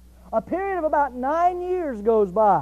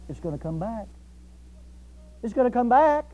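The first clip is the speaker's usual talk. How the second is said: normal talking voice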